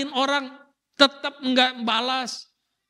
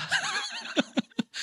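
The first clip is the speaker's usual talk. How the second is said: high, airy burst of laughter; amused laughing at own story; 7 dB quieter than usual. Real, not acted. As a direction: break into a real laugh.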